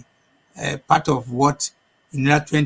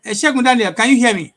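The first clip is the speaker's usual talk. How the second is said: to someone hard of hearing; 6 semitones higher, 5 dB louder, faster than usual. Real, not acted. Calling out to second guest.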